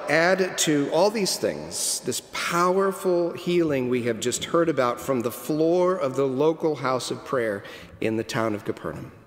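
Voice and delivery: ordinary talk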